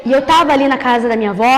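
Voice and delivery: low, soft, hushed storytelling; testemunho em voz contida. Real, not acted. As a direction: tell it low and quiet, holding back before the climax. Hushed, slow-building testimony storytelling. (voz baixa e contida de testemunho)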